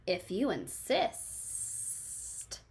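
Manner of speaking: soft, slow, breathy hissing snake. Acted. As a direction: hiss it slowly like a menacing snake. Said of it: "said the snake".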